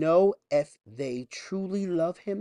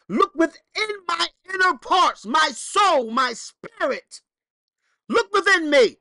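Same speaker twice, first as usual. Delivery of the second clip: high, loud impassioned sermon; full-force preaching; 10 semitones higher, 8 dB louder than usual. Acted. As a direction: cry it out to the church.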